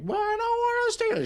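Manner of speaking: squeaky reluctant chat voice; high-pitched whiny viewer. Retold or imitated. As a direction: whine it up high like a viewer wanting out. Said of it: "I know you're all like".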